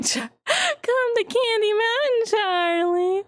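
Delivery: high, slow sing-song lure; Candy Mountain quote. Retold or imitated. Streamer quoting Charlie the Unicorn, slow high sing-song. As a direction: lure him in sweetly and slowly.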